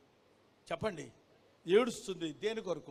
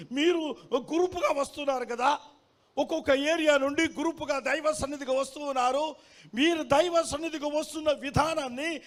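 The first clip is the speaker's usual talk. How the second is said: raised, high-pitched exhortation; urgent preaching; 8 semitones higher, 8 dB louder than usual. Acted. Preacher addressing groups coming to worship, urgent preaching. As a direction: press the point on the listeners.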